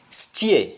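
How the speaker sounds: "steer" said as "stee-eh"; Jamaican pronunciation. Shown as a demonstration. Teacher showing the Jamaican word, "steer" as stee-eh. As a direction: glide from ee to eh in "steer", no r. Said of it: "pronounced, uh, in Jamaican".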